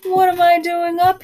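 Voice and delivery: a groundhog shocked to be awake; startled groundhog. Acted. Groundhog startled by his shadow.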